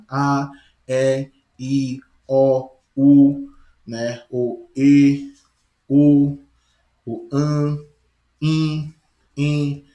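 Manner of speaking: each vowel called out loud and held open; sounding out vowels. Shown as a demonstration. Teacher demonstrating free airflow of vowels to class, loud and open. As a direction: open the mouth and let each vowel ring out. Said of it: "Observe quando digo A".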